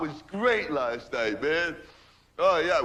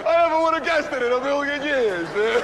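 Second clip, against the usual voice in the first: higher, louder, laughing through it; 10 semitones higher, 4 dB louder than usual. Real, not acted. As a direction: crack up at the punchline. Laughing at his own joke.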